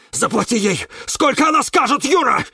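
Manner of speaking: high, loud wailing cry; wailing cactus. Acted. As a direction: wail it out in panic. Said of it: "завопил Кирилл". (вопль кактуса, высоко и громко)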